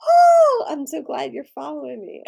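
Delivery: excited. Real, not acted. Greeting a follower.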